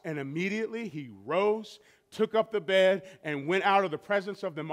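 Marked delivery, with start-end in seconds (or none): none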